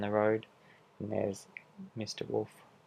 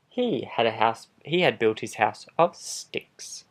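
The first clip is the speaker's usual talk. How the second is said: a lightly voiced sly wolf telling his side; charming wolf pleading innocence, animated; wolf narrator; 2 semitones higher, 14 dB louder than usual. Wolf narrating his own story.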